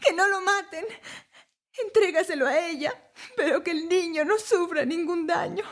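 high, tearful mother begging; anguished pleading. A narrator's reading. True mother begs the king to spare her child, anguished. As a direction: plead desperately in a high, crying voice. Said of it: "exclamó la verdadera madre".

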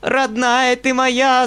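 high, loud, slow shriek; old mistress shrieking at the governess, slow and loud; shrieking old woman. A narrator's reading. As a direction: shriek it on her knees. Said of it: "завизжала она".

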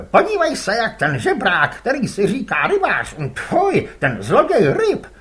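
put-on high, loud boyish jeer; a boy taunting the rival; boy's taunt. Rival's son jeers at the other boat, boy's voice.

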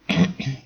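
short throat clear before speaking. A narrator's reading. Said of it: "megköszörülte a torkát". (torokköszörülés)

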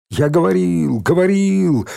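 gleeful clamour. A narrator's reading. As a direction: crow that you knew it all along. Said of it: "восторженно заголосил Валька".

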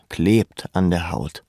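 ordinary talk, nothing marked

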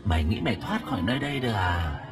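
soft, eerie taunt rising high; cackling ghost. A narrator's reading. Ghost mocking victims who cannot escape, eerie high taunt. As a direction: taunt like a gloating female ghost. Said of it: "Tiểu Yến lúc này cười khanh khách".

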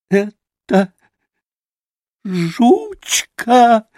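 high, halting cry of pained happiness; cracked joyful cry. A narrator's reading. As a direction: cry out in a cracked little voice, overcome. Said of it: "прокричал он вдруг надтреснутым от страдания и счастья голоском".

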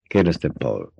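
usual speaking voice

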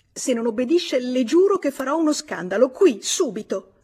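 high, loud, agitated threat; threatening ultimatum. Acted. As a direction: press it hard, slowing on the last words. She threatens a scandal.

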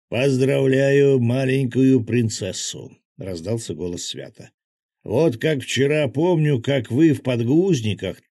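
teasing Svyat. A narrator's reading. Svyat voiced over radio.

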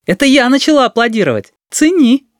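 put-on high girlish call; high-pitched, lively shout; shouted in passing. A narrator's reading. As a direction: call it out high and proud while running by. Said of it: "крикнула на ходу".

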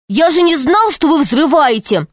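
put-on high-pitched, protesting boy; boy making excuses. A narrator's reading. Boy excusing himself to angry man. (мальчишка оправдывается, высоким голосом)

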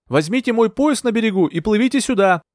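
high, urgent calling to shore; shouted order. A narrator's reading. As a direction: call out instructions across water. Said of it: "крикнула я".

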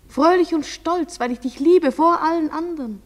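fervent declaration. Acted. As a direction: urge your lover with rising passion. Lover urging him to be proud. (leidenschaftlich beschwörend)

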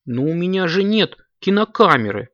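a touch of confusion; bewildered. A narrator's reading. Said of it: "растерялся котёнок".

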